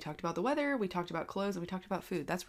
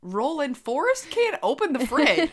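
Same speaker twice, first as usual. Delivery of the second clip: laughing explanation; 8 semitones higher, 8 dB louder than usual. Retold or imitated. Mom answering son's request.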